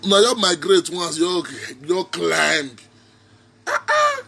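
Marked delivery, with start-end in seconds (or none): 3.7-4.2 squealed "ah, ah" in disbelief; high disbelief. Real, not acted. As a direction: shriek it much higher in mock shock. Mock wail at the news.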